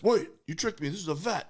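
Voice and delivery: high, swooping voice for a tricked dog; indignant dog. Retold or imitated. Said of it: "she realized".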